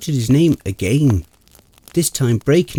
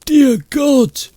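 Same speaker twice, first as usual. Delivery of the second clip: much higher, horrified cry; horrified exclaim; 13 semitones higher, 4 dB louder than usual. Acted. Friend sees the hanging body, horrified exclamation. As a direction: recoil at the sight. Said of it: "Kendrick exclaimed".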